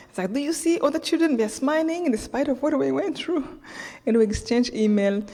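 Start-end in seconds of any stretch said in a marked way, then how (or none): 0.3-3.4 much higher, lively calling out; a father urging his daughter to look, voice lifted; eager call to daughter. Retold or imitated. Man repeats to daughter.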